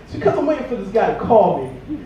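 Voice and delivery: ordinary talk, nothing marked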